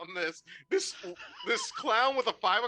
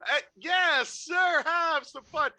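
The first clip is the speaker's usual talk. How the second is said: nervous jolly clown; 9 semitones higher, slower than usual. Acted. Clown placating the sundae man with free treats. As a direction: force a panicky grin through it.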